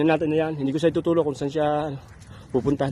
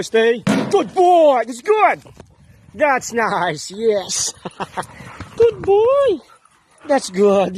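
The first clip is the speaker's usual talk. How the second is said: talking to a dog; 3 semitones higher, much slower than usual. Real, not acted. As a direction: gush over the good dog. Praising dog, pitch jumps.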